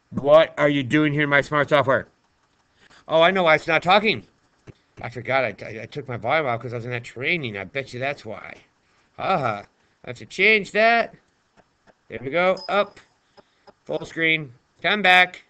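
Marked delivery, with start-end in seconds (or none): none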